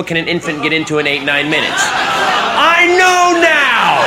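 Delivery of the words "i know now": drawn-out shout. Acted. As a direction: wail it out in despair.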